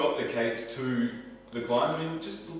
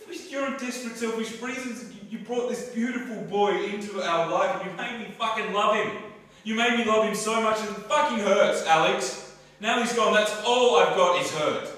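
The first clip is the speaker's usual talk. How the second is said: loud, raw, grieving rage; shouting grief at the one who caused it; hurt furious shouting; 4 semitones higher, 7 dB louder than usual. Acted. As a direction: let the hurt burst out. Heated breakup fight, grief.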